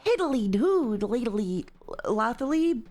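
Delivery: animation voice. Acted. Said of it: "I just memorized that voice in an animation".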